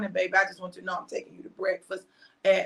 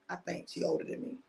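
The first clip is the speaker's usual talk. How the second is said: ordinary talk, nothing marked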